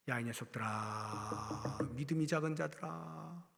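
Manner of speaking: low, slow, soft chiding; Jesus softly rebuking frightened disciples; gentle rebuke. Acted. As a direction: scold them quietly like a patient master.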